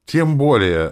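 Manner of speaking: high, loud emphatic retort; exclaimed. A narrator's reading. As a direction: burst out in protest. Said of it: "воскликнула она". (громко воскликнув)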